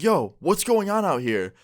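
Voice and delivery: high, drawn-out call imitating a friend; retold puzzled call. Friend coming onto the porch, retold puzzled call. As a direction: call out slowly, pitched well up.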